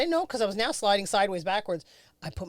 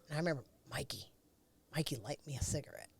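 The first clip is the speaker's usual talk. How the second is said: whisper; 4 semitones lower, 10 dB quieter, faster than usual. Real, not acted. Whispered aside in story.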